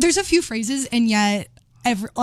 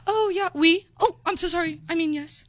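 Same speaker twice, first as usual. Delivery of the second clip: lightly mocked show-off just back from abroad, higher pitch; higher-pitched mock apology; returned study-abroad student; 7 semitones higher than usual. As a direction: play a faux-apologetic traveller catching a slip. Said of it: "That person who comes back from abroad and is like".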